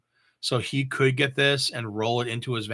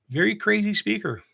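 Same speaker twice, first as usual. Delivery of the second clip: higher, amazed lift on crazy speaker; excited; 9 semitones higher than usual. Real, not acted. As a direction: marvel at how wild this speaker is. Marvels at a huge speaker.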